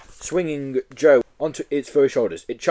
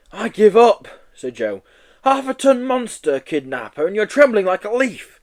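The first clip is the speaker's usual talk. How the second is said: exasperated; 9 semitones higher, 5 dB louder than usual. Acted. Joe exasperated.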